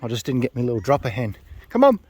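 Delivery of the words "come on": high, loud call to the pigeon. Real, not acted. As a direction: urge it down onto the board. Calling the bird in.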